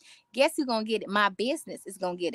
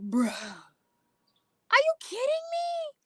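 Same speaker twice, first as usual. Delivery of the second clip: incredulous; 4 dB louder, much slower than usual. Retold or imitated. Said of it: "the more I'm like".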